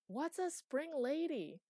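high, loud, drawn-out yell; shouting. A narrator's reading. Chloe to the departing master, shouting. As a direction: holler the question down a stairwell. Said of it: "shouted Chloe".

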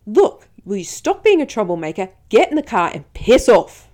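officer's loud angry yell at the caller. Retold or imitated. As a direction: yell at her to piss off. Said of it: "hurt your ears just then when I sort of, like, yelled".

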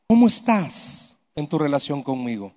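low, soft, measured question; lightly voiced God, hushed and low; God speaking inwardly. God asking the preacher's heart after surgery, low and soft. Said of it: "comenzó a hablarle a mi corazón".

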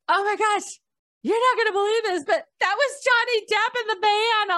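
very high, thrilled imitation of a starstruck guard; flustered gushing. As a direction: squeal the big news like a fangirl. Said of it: "So they were really flustered".

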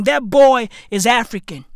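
ordinary talk

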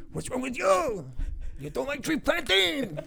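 high, loud taunting boss voice; mocking boss. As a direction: taunt the crying girl in a squeaky boss voice. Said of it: "he's going".

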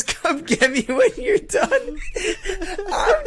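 cracking up mid-story; real laughter. Real, not acted. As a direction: squeeze the words out through laughter. Laughing hard while retelling.

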